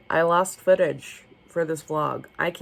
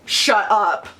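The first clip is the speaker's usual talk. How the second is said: gasp, then a high, loud shut up; thrilled disbelief at a pretty book; delighted gasp; 7 semitones higher, 5 dB louder than usual. Real, not acted.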